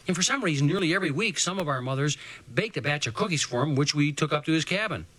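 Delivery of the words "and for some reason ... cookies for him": ordinary delivery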